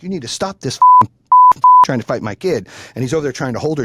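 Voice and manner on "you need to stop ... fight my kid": angry yelling at cop. Retold or imitated. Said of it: "I'm like".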